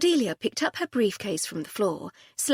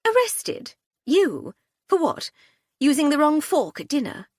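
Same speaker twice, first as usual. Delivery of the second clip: scoffing, pitched high and louder; derisive scoffing; 8 semitones higher, 7 dB louder than usual. A narrator's reading. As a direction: sneer at the very idea with a high scoff. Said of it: "snorting derisively".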